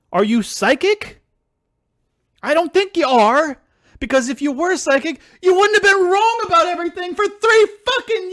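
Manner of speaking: high, loud, incredulous outburst; real angry yelling. Real, not acted. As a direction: yell it in exasperated outrage, pitch soaring. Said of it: "sorry for yelling".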